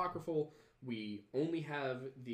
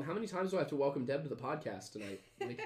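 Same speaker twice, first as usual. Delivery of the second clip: chuckling through the words; laughing; 4 semitones higher, faster than usual. Real, not acted. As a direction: laugh while complaining to Mom. Teasing mom, laughing.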